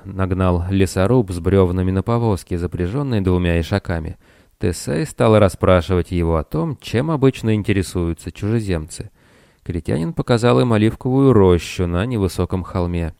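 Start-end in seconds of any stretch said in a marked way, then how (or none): none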